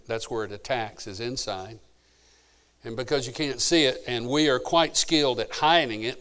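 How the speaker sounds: usual speaking voice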